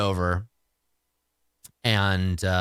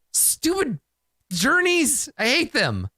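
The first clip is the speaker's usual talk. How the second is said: annoyed grumble; 10 semitones higher than usual. Real, not acted. Player annoyed at another miss.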